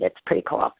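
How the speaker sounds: whispering. Real, not acted. Aside to the audience.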